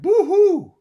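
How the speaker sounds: very high loud boo-hoo wail; crying rat. Acted. The scared rat crying out in a high loud wail. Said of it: "who cried".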